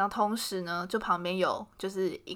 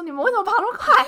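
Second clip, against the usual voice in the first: mock yell; 11 semitones higher, 6 dB louder, faster than usual. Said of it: "然后我们在街上就大叫".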